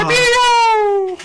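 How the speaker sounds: high, drawn-out mock cry of a name; American Dad reference. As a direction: wail the name way up high, comically. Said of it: "It's a little American Dad reference".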